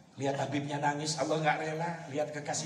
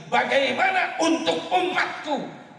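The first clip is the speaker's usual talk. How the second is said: high pleading question; 5 dB louder, slower than usual. Acted. Prophet asks about his people.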